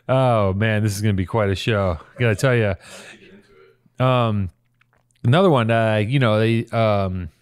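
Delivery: ordinary talk, nothing marked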